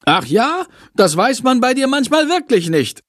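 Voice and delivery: teasing. Acted. Girl teases classmate.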